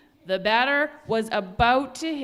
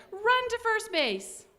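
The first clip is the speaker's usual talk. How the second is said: yelling; 11 semitones higher than usual. Acted. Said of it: "yelled the boys".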